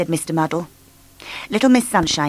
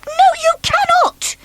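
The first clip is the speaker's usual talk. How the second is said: very high, loud outburst; explosive refusal; 4 dB louder than usual. Acted. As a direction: explode with fury. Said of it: "exploded Miss Bossy".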